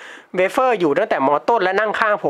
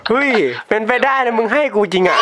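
excited disbelief; 7 semitones higher than usual. Real, not acted. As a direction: shout it out, can't believe it. Friend agrees to lend money.

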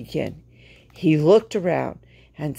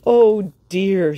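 alarmed, higher and louder; a fretful cry of dismay; worried exclamation; 4 semitones higher, 5 dB louder than usual. Acted. Stuck wagon, worried exclamation.

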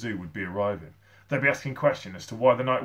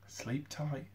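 soft, slightly raised voice; tender good night; 4 semitones higher, 10 dB quieter than usual. A narrator's reading. Boy to sleeping gorilla friend, soft good night. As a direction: murmur it softly, as at bedtime.

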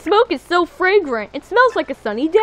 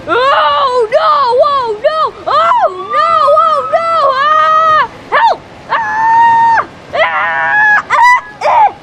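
very high, loud screams; terrified shrieks; panicked screaming; 9 semitones higher, 5 dB louder, much slower than usual. Real, not acted. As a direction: scream in terror. Panicked screaming during chase.

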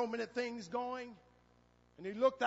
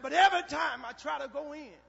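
high, loud, quick complaint; preacher acting out a frustrated plea; retold protest; 7 semitones higher, 11 dB louder than usual. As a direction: voice a boy's urgent objection. Boy protests about the guards.

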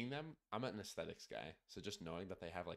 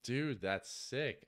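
excited; 5 semitones higher, 5 dB louder, much slower than usual. Real, not acted. Reacting to cool box art.

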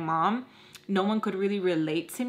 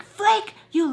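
sharp high call of a name; mild mock snap; 12 semitones higher, 6 dB louder than usual. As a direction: snap the name like a scolding mom. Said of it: "her mom snapped at her".

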